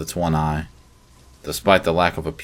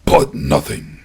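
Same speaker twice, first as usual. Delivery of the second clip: roaring; 5 dB louder, slower than usual. A narrator's reading. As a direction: roar to cut him off. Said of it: "the creature roared".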